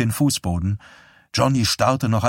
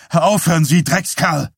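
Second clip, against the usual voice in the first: angry shout; 7 semitones higher, 5 dB louder than usual. Acted. Dussault shouts at Johnny.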